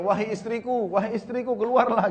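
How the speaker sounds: retold frantic calling. Jabir calling his wife from outside their house, retold frantic calling. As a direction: call out high, as if from outside the house. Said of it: "lalu teriak-teriak di depan rumahnya".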